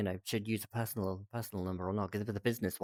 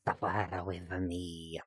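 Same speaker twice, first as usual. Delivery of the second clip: low, slow mock-ominous invitation; playfully menacing, slowed right down; 5 semitones lower, 5 dB quieter, much slower than usual. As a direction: draw it out low, like a playful threat. Mock-sinister invitation to cringe along.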